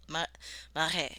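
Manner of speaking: normal talking voice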